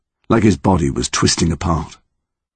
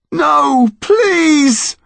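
desperate pleading; 5 dB louder than usual. Acted. Terrified pleading in darkness.

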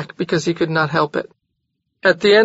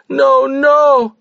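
loud, high panicked no; frantic; 8 semitones higher, 5 dB louder than usual. A narrator's reading. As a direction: rush to stop him. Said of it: "she rushed on".

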